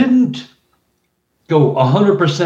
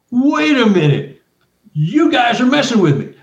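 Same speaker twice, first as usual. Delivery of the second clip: sudden realization; 9 semitones higher than usual. Retold or imitated. Realizing trick, high.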